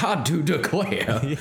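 mock Southern belle, slow and stretched; slow Southern drawl. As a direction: drawl it out slowly like a Southern lady. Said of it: "Everybody's like".

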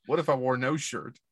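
usual speaking voice

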